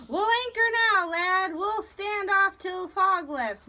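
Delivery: loud, high-pitched sailor's call. A narrator's reading. Sailor urging anchor until fog lifts, calling out. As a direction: raise the voice over the deck.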